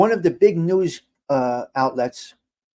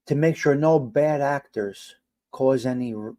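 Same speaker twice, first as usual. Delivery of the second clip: usual speaking voice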